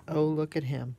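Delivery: normal talking voice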